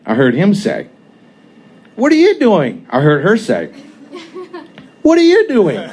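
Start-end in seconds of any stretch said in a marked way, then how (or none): 1.9-2.9 her voice. A narrator's reading. Voices her again, higher. 5.0-5.8 laughing quote. A narrator's reading. His voice, much higher, laughing.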